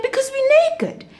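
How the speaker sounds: high, small embarrassed voice; sheepish confession. Acted. Adam and Eve confess to God, high and sheepish. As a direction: own up, a bit embarrassed.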